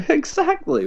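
high, laughing exactly. Real, not acted. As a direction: squeak it out through a laugh. Laughing agreement.

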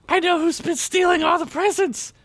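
put-on very high, urgent child voice; little girl informant. A narrator's reading. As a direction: reveal the secret like an excited small girl. Little girl reveals the thief.

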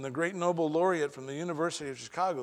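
ordinary talk

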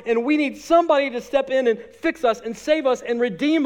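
high, loud, fast preaching; full-force preaching. Acted. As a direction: drive the point home hard.

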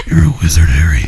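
deep gravelly villain rasp; low menacing growl, close to mic; supervillain voice. Pretend. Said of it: "I sound like a supervillain".

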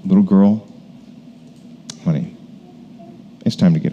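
put-on soft, gentle call to a sleeping child; tender wake-up. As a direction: wake her like a father after a nap. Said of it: "like waking up his daughter from a nap".

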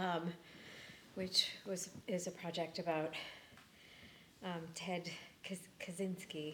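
breathless from running, quiet and halting; out of breath. Real, not acted. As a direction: still out of breath, gulping air. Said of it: "I'm still out of breath from running".

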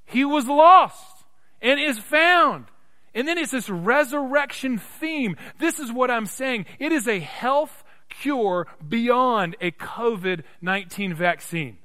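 high, loud, drawn-out proclamation; full-force preaching. Real, not acted. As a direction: ring it out over the congregation.

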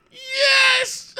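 high, loud cheer of delight; long, squealed celebratory shout; ecstatic yell. Retold or imitated. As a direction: scream it in hammed-up glee. Delighted reaction to tweet, retold.

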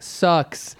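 ordinary delivery

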